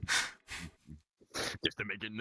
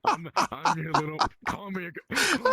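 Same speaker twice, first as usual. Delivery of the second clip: high excited pleading; 10 semitones higher, 7 dB louder than usual. Pretend. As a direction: pitch up, fast, pleading for praise. Mock mommy ASMR begging.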